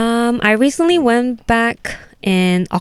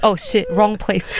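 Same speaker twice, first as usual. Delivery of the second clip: embarrassed aside; slower than usual. Retold or imitated. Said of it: "It's like".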